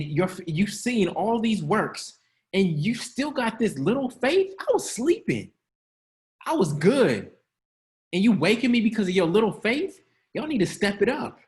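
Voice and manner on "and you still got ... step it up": mock exasperated Jesus. Shown as a demonstration. Jesus woken in the boat, exasperated. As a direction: scold the doubters. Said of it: "that's literally how it translated".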